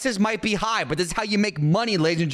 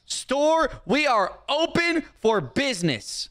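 raised pitch, drawn-out proclamation; grand opening announcement; 8 semitones higher, slower than usual. Pretend. Shopkeeper declaring the store open, high proclaiming voice. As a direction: announce it slowly to the whole store.

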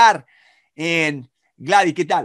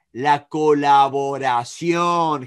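very slow, lower-pitched emphasis; drawn-out key word; 4 semitones lower, much slower than usual. Shown as a demonstration. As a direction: stretch each syllable to drive it home. Teacher spells out the key word. (énfasis muy lento y grave, sílaba por sílaba)

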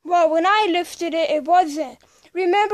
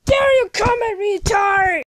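loud, higher taunting yell; shouted insult; 4 semitones higher, 7 dB louder than usual. Acted. Student yelling a taunt at a classmate, loud. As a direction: hurl it across the room at him.

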